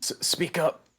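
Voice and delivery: put-on high, stuttered old farmer command; old-timer calling out in a high voice. Old farmer to silent strangers in the dark, high stammered demand. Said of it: "to be an old farmer".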